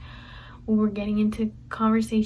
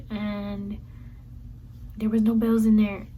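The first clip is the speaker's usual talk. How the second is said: ordinary talk, nothing marked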